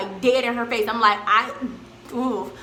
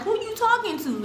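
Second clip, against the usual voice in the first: confrontational challenge; 11 semitones higher than usual. Retold or imitated. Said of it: "looking like".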